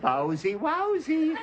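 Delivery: high squeaky wowsy repeated through laughter; laughing camp dog cry; mock effeminate dog. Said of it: "don't you get it".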